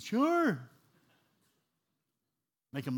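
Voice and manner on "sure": put-on high, loud drunken yelp; hammed-up drunk man's eager shout; drunk new convert. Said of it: "He goes".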